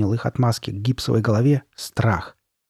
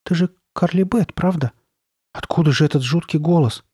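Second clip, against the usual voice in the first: put-on higher-pitched, uncertain boy; unsure; 6 semitones higher than usual. A narrator's reading. Boy questioning the masked girl, unsure. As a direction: ask it high, seeking reassurance. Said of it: "он неуверенно спросил".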